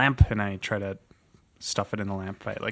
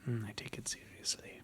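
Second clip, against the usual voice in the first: whisper; 16 dB quieter than usual. Acted.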